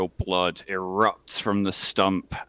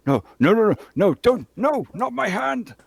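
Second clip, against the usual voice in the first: desperate pleading; 12 semitones higher, 4 dB louder, faster than usual. Acted. Victim pleading before axe falls.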